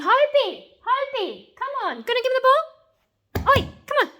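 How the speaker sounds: high, squeaky pet-calling voice; guinea pig voice. Real, not acted. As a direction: squeak at the pet to get him going. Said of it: "Guinea pig noise gets him going".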